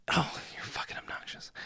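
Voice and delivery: breathless whispered dig; muttering an insult at the screen. Real, not acted. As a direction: hiss it under your breath.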